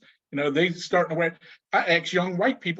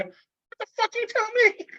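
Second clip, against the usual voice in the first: much higher, incredulous mock snap; snapped back. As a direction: burst out in stunned disbelief. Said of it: "you snapped".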